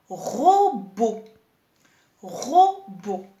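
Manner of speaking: "robot" slowly in two syllables, ro - bo; dictée syllabée. Shown as a demonstration. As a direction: stretch and stress each syllable of "robot". Teacher dictates the word slowly. (« robot » lentement, syllabe par syllabe)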